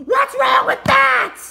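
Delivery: slow, loud, high indignant cry; outraged shout. Acted. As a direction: gasp then shout in outrage. Outraged character.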